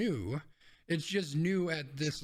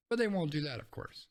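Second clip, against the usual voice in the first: normal talking voice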